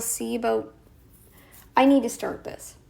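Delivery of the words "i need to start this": ordinary talk, nothing marked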